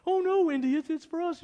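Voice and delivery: lightly voiced woman clinging to the prize; Elsa protesting. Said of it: "Elsa answers Indy".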